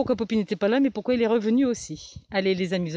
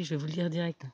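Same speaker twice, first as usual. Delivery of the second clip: ordinary delivery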